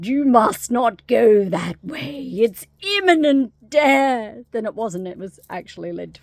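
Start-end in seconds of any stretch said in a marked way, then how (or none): 0.0-4.4 loud, slow, hammed-up warning; mock-ominous prophecy rising in pitch; doom-warning door. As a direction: proclaim doom, stretching every word. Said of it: "um, he just goes on".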